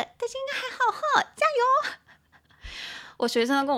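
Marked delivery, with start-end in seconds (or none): 0.0-2.0 high-pitched squeaky cheer. Real, not acted. Teacher tells students they'll be fine, high cheering.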